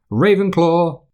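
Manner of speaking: high, ringing announcement of the house; Sorting Hat call. A narrator's reading. The hat calling out the new student's house.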